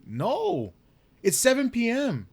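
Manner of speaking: very high retold protest; exasperated insistence. Acted argument with sleepy dad.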